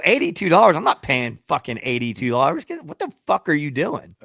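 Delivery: retold outrage at being overcharged; indignant protest. Rider refuses cabbie's eighty-two dollar fare, indignant.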